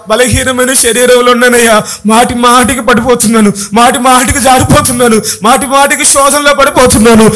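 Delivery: urgent, rhythmic pleading; imploring God, drawing out the last pleas; acted prayer cry. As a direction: plead insistently in repeated waves. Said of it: "వేసిన కేకలు ఏంటంటే".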